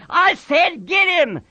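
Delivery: high, insistent yell. A narrator's reading. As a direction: drive the order home. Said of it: "George yelled again".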